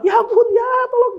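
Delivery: hammed-up high, loud cry for help; acted panic. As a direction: shout helplessly in mock panic. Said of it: "Nah, itu panik". (jeritan panik minta tolong dibuat-buat)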